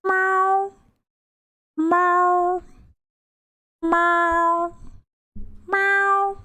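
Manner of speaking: high, cute meow; playful kitty meow; cat meow. Pretend. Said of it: "Where are you hiding the cat".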